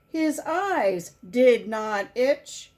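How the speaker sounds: usual speaking voice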